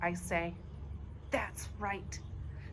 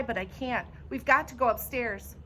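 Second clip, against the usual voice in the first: plain reading voice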